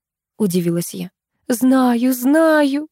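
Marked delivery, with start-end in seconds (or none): none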